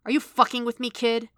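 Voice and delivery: irate disbelief; angry challenge. A narrator's reading. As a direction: accuse him sharply. Stick angrily challenges Matt.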